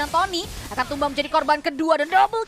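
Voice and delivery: loud esports call; excited play-by-play. Real, not acted. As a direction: ramp up for the double kill. Live play-by-play of kills. (komentar seru)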